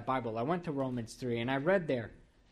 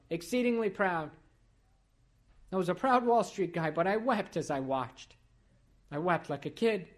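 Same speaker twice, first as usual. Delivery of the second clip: higher, louder preaching climax; fervent preaching; 5 semitones higher, 5 dB louder than usual. Acted. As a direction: proclaim it with swelling force. Preaching pride, rising force.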